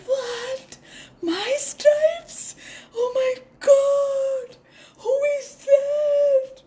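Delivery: very high, loud gasping shock; shocked zebra. A narrator's reading. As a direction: zebra hearing of her stripes. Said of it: "And zebra said".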